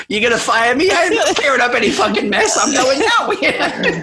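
high mock indignation breaking into laughter; real giggles. Real, not acted. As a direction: protest while fighting the giggles. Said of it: "sorry, I've got the giggles".

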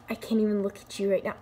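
ordinary talk, nothing marked

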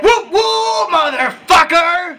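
high, loud whooping holler; exuberant, drawn-out hype shout; triumphant catchphrase shout. Acted. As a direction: whoop it high, then stretch out the curse. Calls out his catchphrase in triumph.

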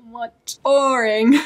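mock letdown; unimpressed reader. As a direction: react with disappointed disbelief, then dismiss it. Acts out reaction to book's ending.

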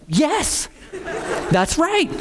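high-pitched eager agreement; delighted confirmation. Retold or imitated. Preacher retelling that his friend finally understands, delighted. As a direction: confirm it with mock triumph.

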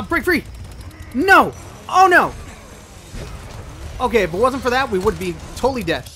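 panicked urging to run; high frantic shouts while fleeing; being chased. Real, not acted. Fleeing enemies in game.

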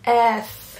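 ordinary delivery

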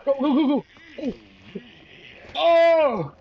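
high frantic yells of go, then a scream; panicked yelling. Real, not acted. Fleeing a monster, she screams in panic. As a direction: shriek while running for your life.

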